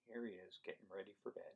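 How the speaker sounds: soft, hushed storybook voice. Acted. Child getting ready for bed.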